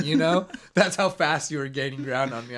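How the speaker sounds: ordinary talk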